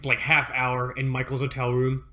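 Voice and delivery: normal talking voice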